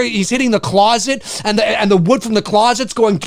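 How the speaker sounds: ordinary delivery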